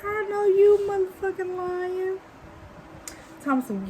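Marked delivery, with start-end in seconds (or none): 0.1-2.2 incredulous call-out. Retold or imitated. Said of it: "and I was like".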